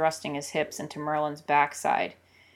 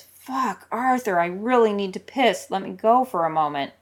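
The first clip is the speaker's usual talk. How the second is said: higher, louder, desperate plea; urgent plea; 4 semitones higher, 5 dB louder than usual. Acted. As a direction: beg him to let go urgently. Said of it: "And then Merlin says".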